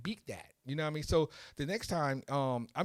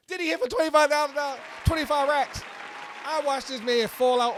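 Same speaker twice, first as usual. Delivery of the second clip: loud and high, stunned; real burst of excitement at the win; excited shout; 11 semitones higher, 9 dB louder than usual. Real, not acted. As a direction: shout it out in disbelief. Retelling the big win.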